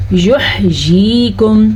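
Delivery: یحییکم with an audible, held throat "h"; clear throat ha. Shown as a demonstration. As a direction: slowly recite یحییکم, stretching "yee". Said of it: "تو ہم اس کو پڑھیں گے".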